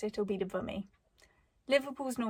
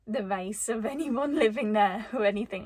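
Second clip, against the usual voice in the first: laughing through an awkward aside, louder; 7 dB louder than usual. Real, not acted. Awkward joke about the name.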